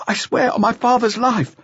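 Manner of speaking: high, strained, frightened plea; desperate pleading. Acted. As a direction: beg in panic. Terrified youth pleading.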